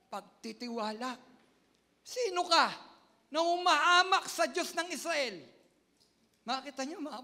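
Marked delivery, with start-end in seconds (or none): 2.0-5.4 higher, louder battle challenge; young David shouting at Goliath; defiant challenge. Acted. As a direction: hurl the challenge at the giant.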